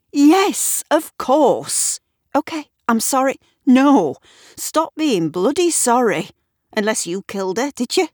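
put-on high, loud irritated old man snapping; loud fed-up scolding; exasperated outburst. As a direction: bite back at the endless apologies. Eric exasperated at Gavin's apologies.

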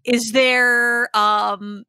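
ordinary delivery

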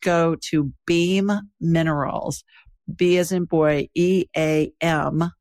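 calm, even sponsor read. Acted. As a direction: read the ad steadily and clearly. Host reads sponsor ad.

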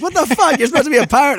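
high-pitched mock outrage; pissed-off friends. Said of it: "All my friends were so pissed".

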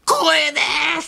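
high, drawn-out overwhelmed cry; strained high-pitched exclamation, hammed-up; taxi driver's cry. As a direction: imitate an awestruck driver crying out, stretching the vowels.

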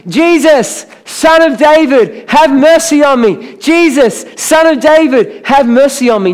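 high, loud, stretched-out cry for help; a blind man shouting to be heard over a crowd, pleading loudly; desperate yell. Acted. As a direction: call out over the crowd, begging. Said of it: "the blind guy yells out".

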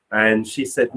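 usual speaking voice

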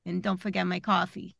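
light New York gecko voice, barely shifted; casual New York gecko voice, like an ad spokesman; New York accent. Pretend. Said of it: "he could be from New York".

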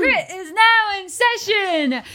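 loud, high proclamation; town crier. Acted. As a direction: call the court into session. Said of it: "I think it's time to say".

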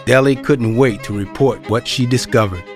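ordinary delivery

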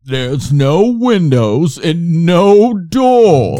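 loud, high, drawn-out theatrical announcer; mock-spooky show narrator at full volume; hammed-up voiceover. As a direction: boom it out very slowly, stretching the vowels. Mimicking a hammy old voiceover.

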